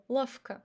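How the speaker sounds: lavka said lafka with a clear f; lavka, v sounds as f; devoiced v. Shown as a demonstration. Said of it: "we say f instead of v".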